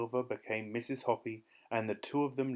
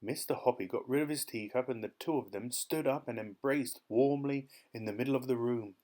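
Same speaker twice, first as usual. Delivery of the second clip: ordinary delivery, nothing marked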